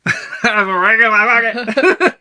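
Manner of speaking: put-on high, loud and slow drunken slur while laughing; drunken sailor. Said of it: "He says like a drunken sailor".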